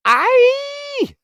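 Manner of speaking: very high loud shriek. Acted. Flap shrieking while falling in the dark. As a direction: let out a scared shriek. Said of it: "shrieked Flap".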